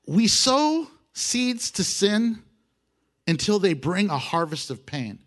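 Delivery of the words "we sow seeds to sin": slow, drawn-out preaching line; slow emphasis. Acted. Preacher to congregation, slow stressed sow.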